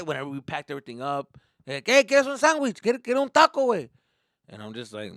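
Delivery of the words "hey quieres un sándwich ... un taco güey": loud, high cheerful father; booming friendly dad; dad offering food. Retold or imitated. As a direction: offer him a taco warmly loud.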